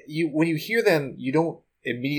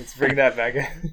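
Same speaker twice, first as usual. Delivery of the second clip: Patrick Warburton impression; 4 semitones higher than usual. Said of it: "Patrick Warburton".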